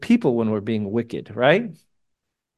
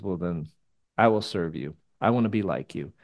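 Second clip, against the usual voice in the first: quiet vow; 6 semitones lower, 6 dB quieter than usual. Retold or imitated. Isaiah's quiet vow retold.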